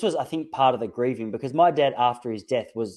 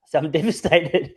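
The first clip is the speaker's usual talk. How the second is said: real nervous laugh mid-sentence; chuckling while admitting devastation; laughing through it; 4 semitones higher than usual. Real, not acted. Laughing as he tells his dying uncle he's devastated.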